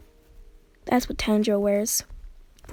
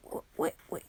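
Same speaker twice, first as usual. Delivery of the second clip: startled whisper; 8 dB quieter than usual. Retold or imitated. Startled figure in retold vision.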